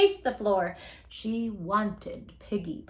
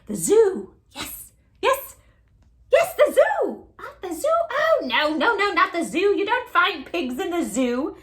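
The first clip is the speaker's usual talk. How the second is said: excited witch; 9 semitones higher, 7 dB louder than usual. Acted. Witch excited then dismissive.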